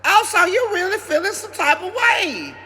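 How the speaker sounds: indignant owner snapping back; sassy challenge. Retold or imitated. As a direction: call the dog out with attitude. Confronting growling dog.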